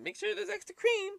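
high-pitched mocking customer voice; squeaky coffee-lady imitation. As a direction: mimic a fussy customer up high. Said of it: "the fucking coffee lady".

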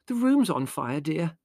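high but contained, softening at the end; motherly explanation. A narrator's reading. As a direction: tell your son patiently, easing off on the endearment. Mother explains gently to indignant son.

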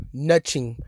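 "nothing" with a ch in the middle, nutching; emphatic NUT-CHING. Real, not acted. Fan driving home his Dallas complaint, emphatic nutching. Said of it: "I said nothing. I didn't say nothing".

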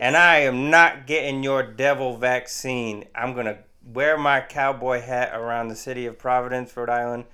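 mock middle-of-the-country accent, loud then easing; hodgepodge Southern-style accent. Mock sovereign citizen refusing the vaccine, in a hodgepodge Southern-style accent. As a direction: start loud in a put-on heartland accent, then settle. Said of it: "why do you have a Southern accent?".

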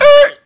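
high, loud "er" of music cutting out; abrupt high vocal sound effect; record-stop noise. Retold or imitated. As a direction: imitate the music screeching to a halt. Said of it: "The music said just".

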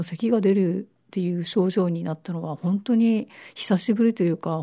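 ordinary talk, nothing marked

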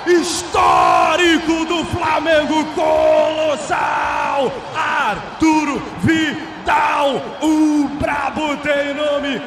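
slow roaring goal call; explosive goal commentary. Acted. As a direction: stretch each word, full power. Goal cry.

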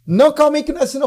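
fast, loud, high protest; agitated objection. Retold or imitated. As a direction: imitate a flustered mother cutting in. Mother stops son leaving, retold.

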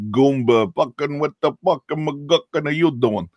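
a Brit hamming up a New York tough guy; bad New Yorker accent. Mocking how Brits fake New York, overdone New York accent. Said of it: "That kind of very New Yorkie thing".

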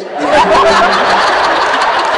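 very high, loud breathy laugh; real squealing laughter; howling laugh. Real, not acted. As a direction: lose it laughing. Laughs at hitting Dobby.

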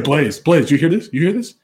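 high, rising, pumped-up calls; excited hype man. Retold or imitated. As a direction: hype him up like a sideline cheerleader. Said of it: "He's like".

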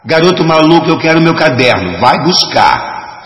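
loud, high-pitched angry shout; nervous yelling. A narrator's reading. Young woman yells at the boy who lost her notebook, nervous shouting. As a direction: yell it, stretching the final command. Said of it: "ouvi uma voz a gritar".

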